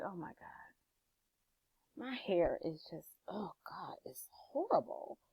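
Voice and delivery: slow, breathy whisper of dismay at the mirror; hushed and horrified, barely voiced; whispered self-criticism. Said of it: "you look and be like".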